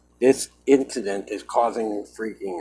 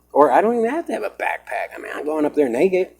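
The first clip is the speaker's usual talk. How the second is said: agitated outburst; 7 semitones higher, 6 dB louder than usual. Real, not acted. Fearful outburst on camera.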